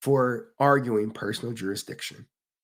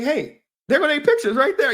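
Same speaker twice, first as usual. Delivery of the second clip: much higher and louder alarmed call; excited warning; 14 semitones higher, 7 dB louder, faster than usual. Retold or imitated. Warning friends about cameras, higher and louder. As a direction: shout the warning up high. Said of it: "And then they like".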